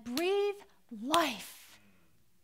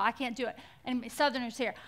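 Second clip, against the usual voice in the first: ordinary delivery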